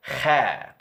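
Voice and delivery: Kha with a raspy scrape from the back of the throat; Kha like clearing phlegm; Arabic kha. Shown as a demonstration. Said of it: "trying to bring up phlegm".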